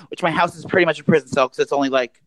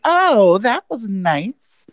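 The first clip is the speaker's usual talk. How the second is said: shady; 6 semitones higher, much slower than usual. Retold or imitated. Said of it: "shadily looking at me".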